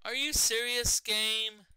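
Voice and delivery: high, loud disbelief at the game; real exasperated outburst; exasperated shout. Real, not acted. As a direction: demand an answer from the game, loudly. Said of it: "a bit exasperated".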